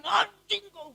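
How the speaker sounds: mock yell. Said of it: "tinggal kita teriakin".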